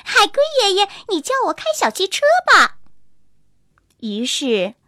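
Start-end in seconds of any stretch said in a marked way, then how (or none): 0.0-2.8 high and fast, little monkey begging; little monkey begs grandpa turtle to teach him; eager plea. A narrator's reading. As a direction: rush the words out, dying to learn. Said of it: "急忙说".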